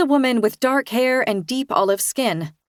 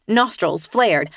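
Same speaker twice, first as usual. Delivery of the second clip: ordinary delivery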